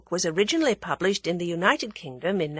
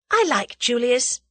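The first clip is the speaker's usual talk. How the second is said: higher, louder opener to test the water; tentatively offered, pitched up; tentative venture; 6 semitones higher, 5 dB louder than usual. A narrator's reading. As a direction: venture it in a raised voice. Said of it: "essayed Tuppence again".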